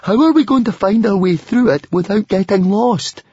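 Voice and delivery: grumble, building to a fretful wail; grumbling. A narrator's reading. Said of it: "Fishlegs grumbled".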